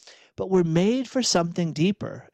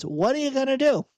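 high-pitched helpless shrug; resigned rhetorical question, nothing to be done; 5 semitones higher, faster than usual. Acted. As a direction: throw your hands up, pitch lifted. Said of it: "throw your hands up in resignation".

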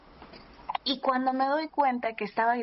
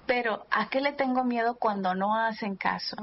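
normal talking voice

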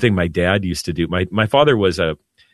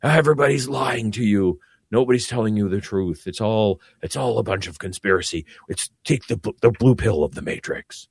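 angry flat earther. Retold or imitated. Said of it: "the angry flat earther who just wants to say".

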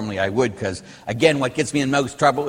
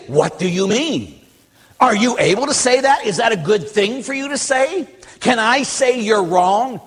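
high, pressing rhetorical questions; confronting challenge; 7 semitones higher than usual. Acted. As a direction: challenge them point by point. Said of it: "we need to confront that and say".

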